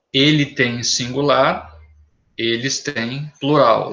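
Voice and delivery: Ele tem and eles têm said slowly side by side; careful teacher contrast; slow contrast demo. Shown as a demonstration. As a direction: set tem against têm slowly for students. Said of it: "leva acento".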